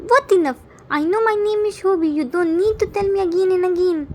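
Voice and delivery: put-on high, loud, fast bratty kid; cheeky child. A narrator's reading. Son talking back to his father, fast and bratty. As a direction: snap back fast like a brat.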